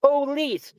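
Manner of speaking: "Police" split into two clear syllables, po-lice. Shown as a demonstration. Showing how he must say it to cops, two syllables. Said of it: "I have to say two syllables".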